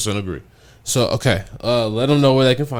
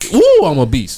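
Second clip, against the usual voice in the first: excited brag; 6 semitones higher, 6 dB louder than usual. Real, not acted. Boasting after fast outro.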